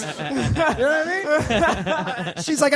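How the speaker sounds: ordinary talk, nothing marked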